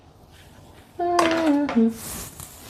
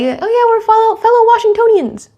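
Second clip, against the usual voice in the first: much higher, cheerful "fellow Washingtonians"; happy greeting; 11 semitones higher than usual. Retold or imitated. Imitating herself greeting a local fan, much higher and cheerful. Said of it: "makes me happy".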